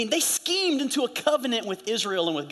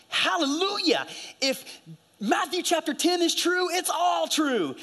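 high-pitched, fervent proclamation; excited proclaiming; 8 semitones higher than usual. Retold or imitated. Husband showing his crying wife scripture at the airport, excited. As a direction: exclaim it high, like a thrilling discovery.

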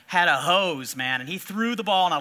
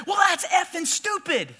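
mom's angry yell; 9 semitones higher than usual. Retold or imitated. Angry mom yelling at the umpire, scornful shout. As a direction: spit it at the umpire. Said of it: "And she goes".

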